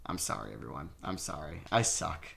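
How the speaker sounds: dejected apology. Real, not acted. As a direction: mumble a beaten apology. Apologizing after losing game.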